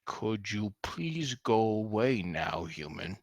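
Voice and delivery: slow, effortful orc politeness, fading at the end; orc asking nicely. Acted. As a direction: plead slowly in a put-on orc voice. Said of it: "if I ask nice".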